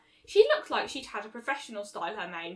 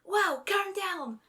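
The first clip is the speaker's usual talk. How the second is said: soothing; 8 semitones higher, much slower than usual. A narrator's reading. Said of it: "Rainbow said quietly".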